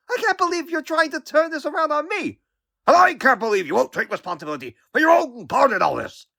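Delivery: high-pitched outraged protest; exasperated. Acted. Hero accusing his old critic mid-argument, exasperated.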